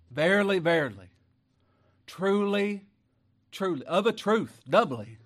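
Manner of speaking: loud, ringing pulpit reading; preaching at full force. Acted. Preacher reading Jesus' words to the church, loud. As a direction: proclaim the verses like thunder.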